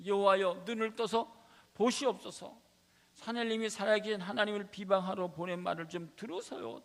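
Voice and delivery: loud, high desperate plea to God; pleading prayer. Acted. King Hezekiah begging God for help, loud desperate plea. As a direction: cry out to God like a cornered king.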